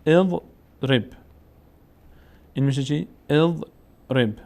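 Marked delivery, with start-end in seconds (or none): 0.0-1.0 «اضرب» very slowly, opening on short e; کسره، شمرده. Shown as a demonstration. 3.3-4.3 «اضرب» drawn out, opening on short e; کسره، شمرده. Shown as a demonstration.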